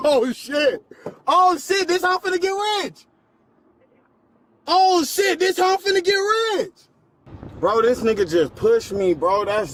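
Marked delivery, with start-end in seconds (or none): 1.3-3.1 sarcastic yell. Real, not acted. Mocking yell on the floor. 4.7-6.7 shouting. Real, not acted. Repeats it shouting.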